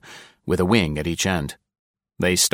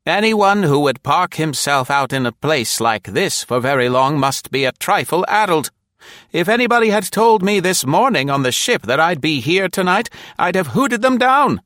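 put-on high, loud, fussy old lady voice; an old dame who is sure she's right; high-pitched elderly lady, firm and loud; old woman with conviction; 10 semitones higher, 5 dB louder than usual. A narrator's reading. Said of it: "said with conviction".

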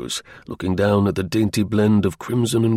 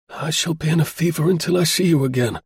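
higher-pitched, mildly fervent plea; understated anxious cry; 6 semitones higher than usual. A narrator's reading. Feverish client to departing Holmes, mildly crying out. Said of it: "cried the diplomatist".

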